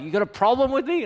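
high, fast mock threatening challenge; an angry man in my face, imitated; aggressive challenge. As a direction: square up to him, hammed-up aggression. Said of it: "He's like".

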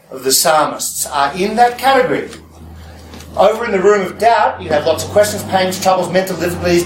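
ordinary delivery

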